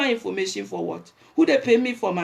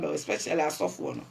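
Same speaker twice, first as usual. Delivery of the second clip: soft mock murmur; 12 semitones lower, 8 dB quieter than usual. Mocking flirty approach.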